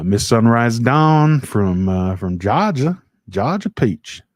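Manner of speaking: put-on loud, playful stab at a Georgia accent. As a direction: greet her in a hammed-up Georgia accent. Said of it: "I don't know what that accent was".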